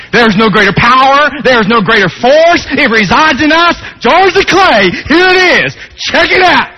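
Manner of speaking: high, loud ringing proclamation; full-force preaching. Acted. Preacher exhorting the congregation, at full force. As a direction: preach it to the rafters.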